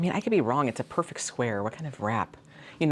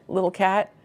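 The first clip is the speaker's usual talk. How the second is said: ordinary talk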